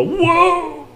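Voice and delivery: high, amazed exclamation with a laugh; awed whoa. Retold or imitated. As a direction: gasp out a wowed whoa. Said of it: "and you go".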